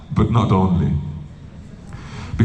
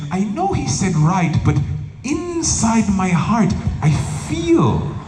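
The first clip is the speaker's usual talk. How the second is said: high, drawn-out mock-earnest devotee; overcomplicating devotee; 6 semitones higher, slower than usual. Devotee explains why he disobeys the guru, mock-earnest. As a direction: stretch the words, pitched up, as if soulfully sincere.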